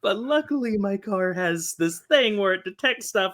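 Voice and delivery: talking high and loud through helpless laughter; laughing. Real, not acted. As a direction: let laughter overrun every word.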